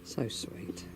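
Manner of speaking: soft unvoiced "so sweet"; whispered fondness. Real, not acted. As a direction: breathe it out tenderly. Whispering fondly at chickens.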